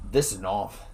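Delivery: low mock Australian accent; Crocodile Dundee. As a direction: lightly imitate an Australian movie hero. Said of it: "Okay, Crocodile Dundee?".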